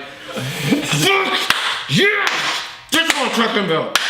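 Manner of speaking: high, fast, thrilled fan shouting; excited outburst. Retold or imitated. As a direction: burst out like a kid at the movies. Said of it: "It's like".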